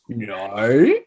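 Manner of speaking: "no" stretched into a sliding Australian vowel, rising at the end; slow, exaggerated Australian "no"; demonstrated Aussie "no". Shown as a demonstration. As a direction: draw out the Aussie glide in "no". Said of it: "the sound you're trying to conquer".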